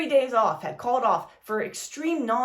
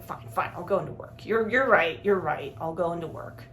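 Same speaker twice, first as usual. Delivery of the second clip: grudging, salty; 8 semitones lower than usual. Retold or imitated. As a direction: give in sulkily under your breath. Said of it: "He was real salty about it".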